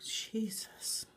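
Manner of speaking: hushed, drawn-out whisper of amazement; soft whispered exclamation; awed whisper. Real, not acted. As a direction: whisper it under your breath, stunned. Awestruck at a glitter color.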